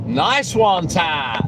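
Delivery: ordinary delivery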